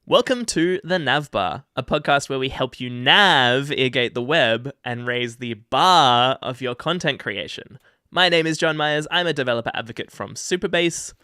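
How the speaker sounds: formal welcome read; podcast intro read. Acted. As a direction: open the show like a host. Podcast intro read, take two.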